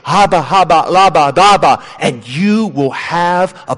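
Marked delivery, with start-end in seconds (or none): 0.0-1.8 mock tongues babble. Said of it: "just say this over and over".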